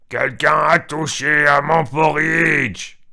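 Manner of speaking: loud, slow growling cry of a papa bear; papa bear's big voice. A narrator's reading. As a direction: boom it out slowly like an indignant papa bear. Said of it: "s'écria avec une grosse voix". (grosse voix de papa ours, forte et lente)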